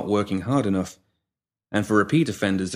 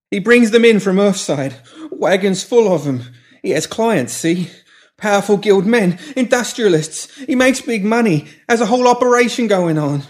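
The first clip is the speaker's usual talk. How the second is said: frantic; 12 semitones higher, 7 dB louder than usual. A narrator's reading. Pimp spilling secrets in fear.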